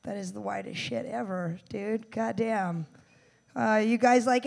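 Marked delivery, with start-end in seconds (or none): none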